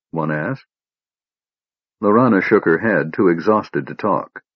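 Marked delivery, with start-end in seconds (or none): none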